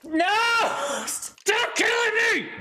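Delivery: high, slow anguished yell; real frustrated scream; desperate shout. Real, not acted. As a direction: scream at the game. Dying again in game.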